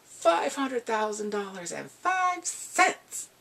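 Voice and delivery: high, slow smug bid; lightly hammed-up smug rich kid; smug overbid. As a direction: stretch it out to taunt her. Then he's like this.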